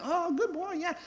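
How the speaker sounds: high silly voice cheering the puppy on a leash walk; silly dog-praising voice. Retold or imitated. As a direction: coo at the puppy in a goofy high voice. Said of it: "you have to say it in that silly voice".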